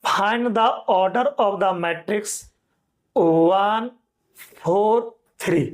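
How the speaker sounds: very slow, word by word dictation. Shown as a demonstration. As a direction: dictate slowly for note-taking. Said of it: "अगला question लिखिए". (धीरे-धीरे लिखवाना)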